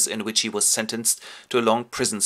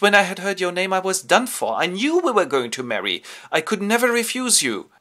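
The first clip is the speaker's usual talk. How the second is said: put-on higher, louder lovestruck girl; eager young lady; 9 semitones higher, 5 dB louder than usual. Gwendolen pushing Jack to propose, eager and higher. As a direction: gush over him.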